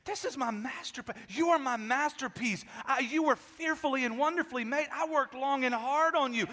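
earnest but lightly voiced God; God pleading. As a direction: voice God protesting over his masterpiece. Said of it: "God is looking at you going".

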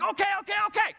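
high-pitched fired-up rapid-fire okays. Retold or imitated. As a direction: imitate a pumped-up little dive guide. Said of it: "and he's fired up".

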